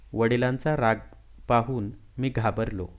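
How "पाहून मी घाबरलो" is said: ordinary delivery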